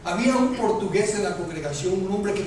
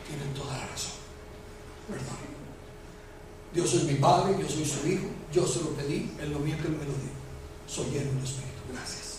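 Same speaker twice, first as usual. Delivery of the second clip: soft, lowered reply; quiet humble concession; 5 semitones lower, 7 dB quieter than usual. Acted. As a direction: concede quietly, voice dropping low. Acted humble answer to challengers. (respuesta humilde, en voz baja)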